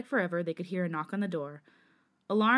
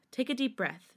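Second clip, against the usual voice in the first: plain reading voice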